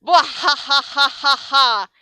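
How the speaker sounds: loud, high villain cackle; the teacher bursts into evil laughter. A narrator's reading. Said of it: "broke into an evil laugh".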